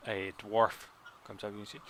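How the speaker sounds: usual speaking voice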